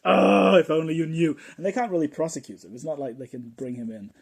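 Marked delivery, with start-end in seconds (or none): 0.1-1.4 loud, anguished mock cry; exasperated wail. CIA laments to unknowing taxi driver, hammed-up exasperated wail. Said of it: "The C I A is just like".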